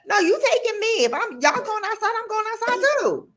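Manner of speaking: put-on high, pleading small child; be the youngest refusing to be left; high child voice demanding to come; insistent little girl.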